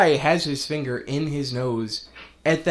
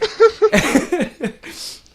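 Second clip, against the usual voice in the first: high loud burst of laughter; big laugh; 9 dB louder than usual. Real, not acted. Laughing at the Zidane line, loud real laugh. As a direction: crack up at the joke.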